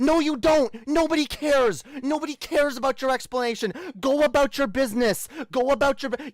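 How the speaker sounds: exasperated angry retort; heated retort. Real, not acted. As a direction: shut him down hard. Cutting off the mocked excuse.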